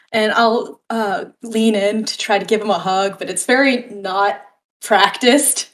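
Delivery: ordinary delivery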